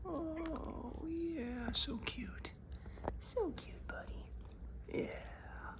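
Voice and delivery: high soft cooing. Real, not acted. Cooing at the cute dog.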